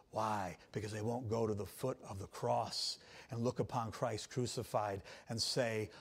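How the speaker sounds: ordinary talk, nothing marked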